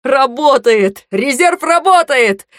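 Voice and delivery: loud, high, drawn-out exclamation; elated, stretched-out cheer; jubilant shout. A narrator's reading. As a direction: cry it out slowly in triumph. Cantor laughs, whirls her, rejoicing.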